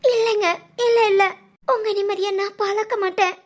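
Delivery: anxious, hurried denial at first, then subdued; tearful pleading. Acted. Wife assuring husband she won't intrude, tearful plea. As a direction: protest humbly, close to tears.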